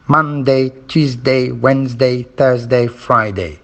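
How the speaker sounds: Monday, Tuesday, Wednesday, Thursday, Friday as even, level beats; rhythm drill. Shown as a demonstration. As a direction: chant each day on a steady beat, falling only on Friday. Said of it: "listen to this, uh, this funny activity".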